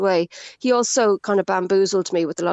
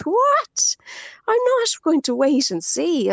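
high, disbelieving cry of "What?"; retold indignant disbelief, much higher; incredulous outburst; 9 semitones higher, slower than usual. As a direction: burst out in outraged disbelief, reliving it. Retelling outrage at the bank's reply.

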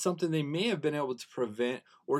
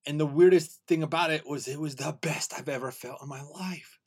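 ordinary talk, nothing marked

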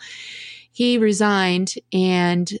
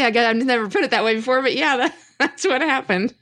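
laughing; 5 semitones higher than usual. Real, not acted. Laughing at blunt breakup framing.

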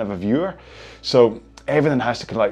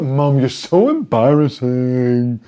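put-on embarrassed teen; 2 semitones higher, 4 dB louder, much slower than usual. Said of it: "I was like".

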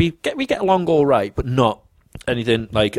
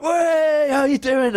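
high drawn-out cheer; mock cheery welcome; 14 semitones higher, much slower than usual. Mates greet their livid friend in the pub, gleefully cheering. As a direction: greet him with a teasing whoop.

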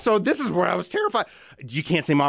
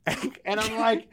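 ordinary delivery

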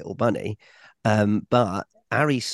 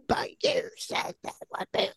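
whispered mock toddler; 7 dB quieter than usual.

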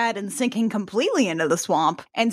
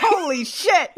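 louder, higher laughing exclamation; laughing surprise; 7 semitones higher, 3 dB louder than usual. Real, not acted. Friend reacting to the skull dress, laughing surprise. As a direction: blurt it out in shocked delight.

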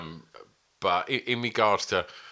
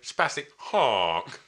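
long, stretched-out words, a little raised; drawn-out quote; much slower than usual. Retold or imitated. Quoting a mate showing him a video, drawn out. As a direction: draw each word right out in mock imitation. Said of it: "he literally went".

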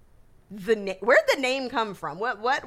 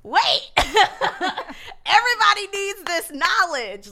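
excited; 9 semitones higher, 4 dB louder than usual. Retold or imitated. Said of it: "I was like".

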